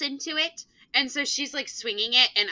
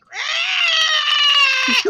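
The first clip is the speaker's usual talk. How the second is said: long, high, loud imitated kid screech; drawn-out mock monster scream; horrendous screech; 9 dB louder than usual. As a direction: screech like a child playing monster. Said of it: "making the most horrendous sound".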